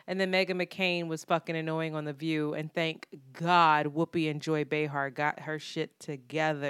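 ordinary delivery

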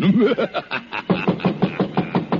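breathy, high villain's cackle; sinister laugh before knocking on the door; evil laugh. Acted. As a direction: laugh with wicked glee at the doom to come. Villain laughs at his plan.

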